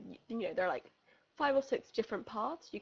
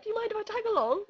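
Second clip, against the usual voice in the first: high, louder, quick imitation; eager stranger; 10 semitones higher, 6 dB louder, faster than usual. Runner asking to join her, quick eager mock voice. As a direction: imitate an over-keen tagalong.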